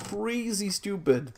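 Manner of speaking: slow, high, disbelieving; can't believe how dumb this is; exasperated. Real, not acted. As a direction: stretch it out in real frustration. Frustrated over lost items.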